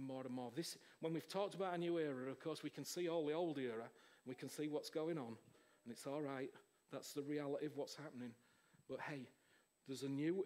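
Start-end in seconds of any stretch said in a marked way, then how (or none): none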